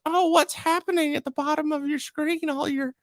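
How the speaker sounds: put-on high quavery old woman; old lady voice. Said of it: "like, old lady voice".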